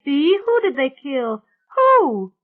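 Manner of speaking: high, loud, urgent cry. A narrator's reading. Mona begs Bea for the victim, crying out. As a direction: demand it in high-pitched alarm. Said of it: "Mona cried".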